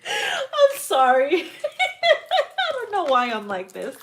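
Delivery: crying with laughter, high and slow; laugh-cry through the apology; helpless laughing apology. Real, not acted. Laughing fit after own joke.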